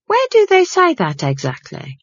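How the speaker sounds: high, pointed housekeeper question; suspicious housekeeper. Acted. Housekeeper doubting Granny's tea-leaf spirits, high and pointed.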